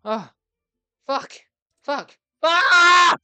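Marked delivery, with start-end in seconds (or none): 2.4-3.1 loud high yell of frustration; short furious shriek; frustrated scream. Real, not acted. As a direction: let out a raw scream. Game failure.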